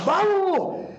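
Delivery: high, urgent, hammed-up shout; provoking coach. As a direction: goad a singer hard for raw emotion. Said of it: "Y yo estaba provocando".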